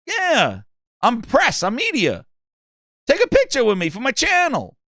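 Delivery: high mock-earnest gamer; self-important press fan.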